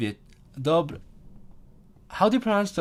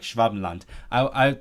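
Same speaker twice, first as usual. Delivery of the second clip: ordinary talk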